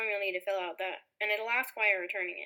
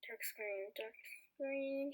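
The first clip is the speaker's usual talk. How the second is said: talking to oneself; 12 dB quieter, much slower than usual. Acted. Support agent noting the caller's problem to himself, softly. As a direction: murmur it while working on the problem.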